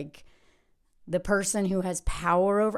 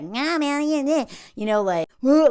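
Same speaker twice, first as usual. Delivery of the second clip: mocking naysayer voice; 6 semitones higher, 6 dB louder than usual. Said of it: "the other voice".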